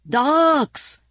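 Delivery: yelling. A narrator's reading. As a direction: yell it down the phone. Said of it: "yelled Michael".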